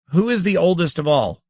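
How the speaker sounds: a high, pressing demand. A narrator's reading. Saren demands an answer from the tiny grandmother, high and pressing. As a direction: insist on an answer from the tiny laughing creatures. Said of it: "Saren demanded".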